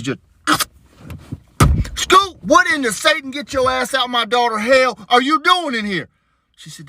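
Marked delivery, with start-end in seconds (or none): 2.0-6.1 exasperated yell. Retold or imitated. Dad catches daughter in kitchen.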